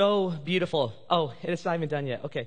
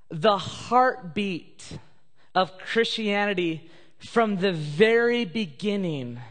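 slow, weighty and raised; slow build of conviction; slow emphatic; 3 semitones higher, slower than usual. Real, not acted. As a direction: draw out the heartbeat.

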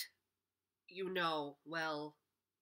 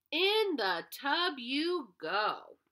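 bossy order; 7 semitones higher, 4 dB louder, much slower than usual. Acted. Mrs Wishy Washy orders animals.

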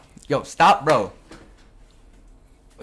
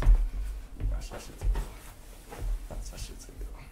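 whisper; 5 dB quieter, much slower than usual. Real, not acted. Muttering to himself, fading.

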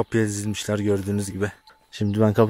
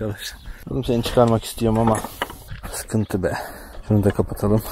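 usual speaking voice

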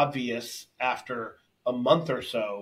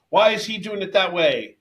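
loud, raised-pitch mock complaint; sideline heckling; 7 semitones higher, 7 dB louder than usual. Disruptive father complaining from the side of class, mock heckling. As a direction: call it out like an interfering parent.

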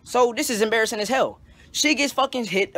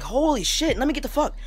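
high, frantic gasp; panicked escape; 7 semitones higher than usual. Retold or imitated. Jumping out the window while mom grabs his ankles, in high panic. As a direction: scramble out in panic.

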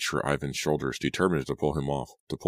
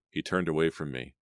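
ordinary delivery

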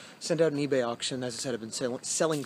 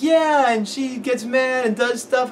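put-on high, slow, earnest little kid; 11 semitones higher, 8 dB louder, much slower than usual. Retelling how the kid described the movie, in a high slow child voice. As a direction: talk like a small boy explaining a movie.

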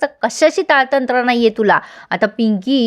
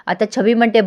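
plain reading voice

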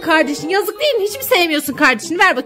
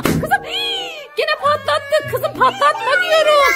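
gasp, then high-pitched exasperated protest; high, alarmed telling-off; dismayed scolding; 10 semitones higher, slower than usual. Acted. Gasps as another balloon pops.

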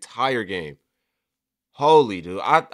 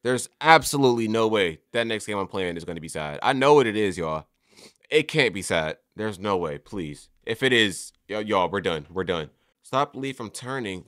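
ordinary delivery

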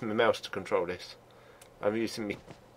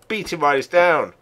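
excited shout; 4 semitones higher, 8 dB louder than usual. Real, not acted. Attacking downed player.

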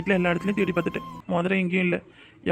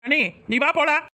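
higher, insistent command; firm order; 6 semitones higher than usual. Acted. Brother insisting his sister-in-law leave with him now. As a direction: pull her away, insisting.

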